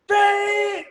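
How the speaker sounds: high, sustained blaring fart sound; mock fart blast; imitated fart noise. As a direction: honk out one long ripping note. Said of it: "like that".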